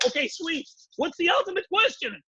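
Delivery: high-pitched, acted eagerness; eager questioners. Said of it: "And they're going".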